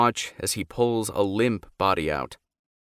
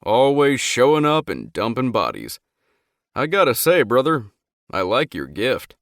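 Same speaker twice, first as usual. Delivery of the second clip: loud, chuckling approval; amused; 4 semitones higher, 7 dB louder than usual. A narrator's reading. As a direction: tease him, laughing a little.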